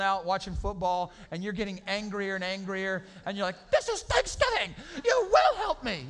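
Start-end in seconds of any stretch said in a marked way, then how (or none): 3.7-6.1 high, loud mock yell; stressed host yelling at the family on Thanksgiving; furious shout. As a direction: explode at the lazy family.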